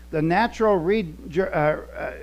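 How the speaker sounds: ordinary delivery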